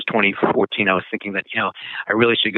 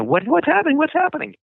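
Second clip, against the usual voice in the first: much higher, alarmed mock panic; panicked classmates. Classmates panicking as the sails flap, high alarmed voice. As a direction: squeal it like scared kids on a boat.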